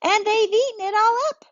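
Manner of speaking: a little one tattling; very high tattling child; upset little child. Acted. Child complaining in story.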